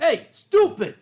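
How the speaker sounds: loud, high accosting yell; imitated guard's shout; border guard shouting. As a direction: yell at someone as an officious guard. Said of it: "a U S border guard, you know that one".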